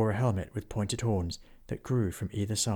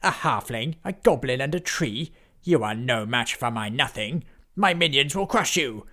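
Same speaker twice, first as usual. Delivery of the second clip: mocking villain; 3 semitones higher, 5 dB louder than usual. Necromancer taunts the friends.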